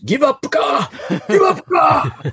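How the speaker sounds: high mocking Japanese English taunt. Kendo teacher taunting his fallen student in Japanese English. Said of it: "in his wonderful Japanese English".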